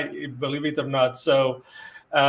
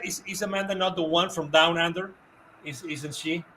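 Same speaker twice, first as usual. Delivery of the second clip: ordinary talk, nothing marked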